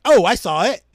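emphatic mock Brooklyn Italian; hammed-up Brooklyn Italian stagehand; Brooklyn Italian accent. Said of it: "Italians from Brooklyn".

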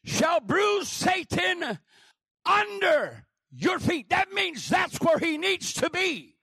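loud, high, fervent pulpit preaching; full-force preaching. Acted. Preacher rousing the congregation with loud fervent preaching. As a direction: preach it out to the whole church.

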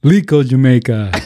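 mock Jamaican ad voice. Shown as a demonstration. Copying his friend's ad read, mock Jamaican. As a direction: echo the island ad line. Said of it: "One more time".

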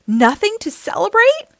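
high, loud incredulous rise; can't believe what she heard; disbelief. Acted. Oxpecker to grumpy Jim, shocked and disbelieving. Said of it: "Oxpecker couldn't believe it".

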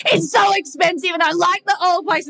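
high, wailing complaint; mock tearful whine. Complaining to Barry about leaving the beach house, mock tearful whine. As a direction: play up a weepy protest.

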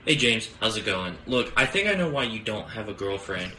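ordinary talk

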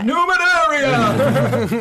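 laughing. Real, not acted. Flubbed line, laughter.

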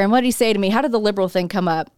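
normal talking voice

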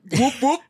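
loud high whooping; high whoop call. Retold or imitated. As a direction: call out like a creature. Imitating vocalizations.

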